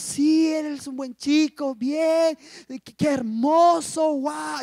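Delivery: high, gushing mock delight; delighted approval. Pastora approving of her new suitor, gushing in a high voice. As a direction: gush over them, stretching the admiring words.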